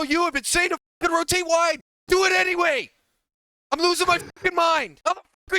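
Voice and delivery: loud, exasperated bark; furious shout. Real, not acted. Coach yelling at his student to just do it, furious shout. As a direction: yell it at the student, fed up.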